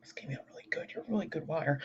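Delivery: soft unvoiced whisper. Real, not acted. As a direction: breathe it to yourself. Unvoiced aside to himself.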